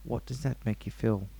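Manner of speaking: low, hushed-down therapist question; put-on psychiatrist. Psychiatrist asks his patient about feelings, low psychiatrist voice. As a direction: drop low and soft like a mock shrink. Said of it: "I'm a psychiatrist".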